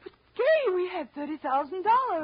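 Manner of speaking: sudden high alarmed cry; shocked protest, jumping up in pitch. Acted. Wife startled by husband's losses, alarmed.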